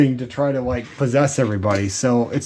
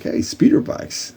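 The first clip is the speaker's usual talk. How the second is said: excited; slower than usual. Retold or imitated. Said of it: "I'm like".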